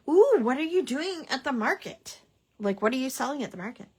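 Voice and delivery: high, loud eager question; bursting with curiosity; excited. Real, not acted. Eager question about viewer's market.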